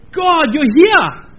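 loud, slow, high cry of awe; awed cry. Pretend. Preacher acting a believer meeting God, loud awed cry. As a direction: call out to God in wonder.

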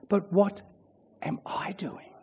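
unvoiced hushed question to the congregation; whisper. Pastor whispering the question to his congregation. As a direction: breathe it, barely audible.

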